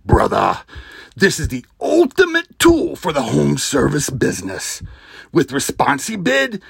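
gravel-throated wrestling promo, loud and drawn out; Macho Man impression. Podcast host hamming up Macho Man for a sponsor ad. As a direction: growl it like a hyped wrestler cutting a promo. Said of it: "my best Macho Man impersonation".

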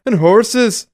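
a loud, high-pitched Liverpudlian yelp. Retold or imitated. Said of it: "in his [name] accent".

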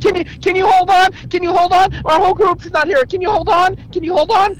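high, loud, pleading tourist; frantic high plea. Retold or imitated. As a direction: beg the driver to wait, shrill and loud. Mimics tourist pleading with driver.

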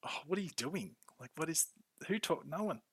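retelling a baffled viewer's reaction. Retold or imitated. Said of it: "I'm like".

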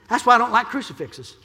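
high, loud preacher's cry; preaching at full force. Acted. Pastor to the congregation, shouting preacher's climax. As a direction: climb to a shouted amen.

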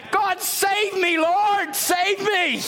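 high, slow, pleading cries; desperate cry to God. Acted. Preacher voicing a sinner's plea to God: desperate high cry. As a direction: beg God to save you, drawn out.

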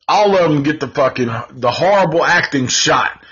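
ordinary delivery

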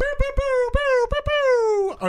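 high trumpet fanfare sung on bow. Acted. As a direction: herald a big announcement. Fanfare before announcement.

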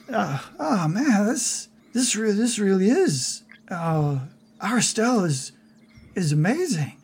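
awed Harry character. Acted.

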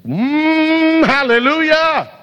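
high, drawn-out revival holler; ornery hallelujah shout. Retold or imitated. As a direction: build from a hum into a high, stretched shout. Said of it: "and I felt like shouting".

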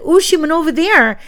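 high, loud pointing call; excited. Retold or imitated. Teacher pointing the children to the fairy, high and loud. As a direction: send the kids running, thrilled. Teacher misleading kids playfully.

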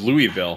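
"Louisville" as "Loo-ee-ville". Shown as a demonstration. Co-host questions the local way, "Louisville" as "Loo-ee-ville". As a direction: say "Louisville" with a clear "ee" middle. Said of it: "I thought it was Louisville".

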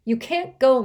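high, loud, fierce protest; ferocious plea to her father. A narrator's reading. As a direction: refuse him with all her force. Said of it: "with a ferocity that surprised even her".

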